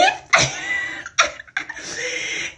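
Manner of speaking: a high breathless shriek of laughter. Real, not acted. Cracking up at the fan's message. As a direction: let out a squealing cackle.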